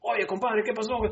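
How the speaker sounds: high-pitched imitation of his dad; dad's Spanish greeting. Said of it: "they sound like my dad".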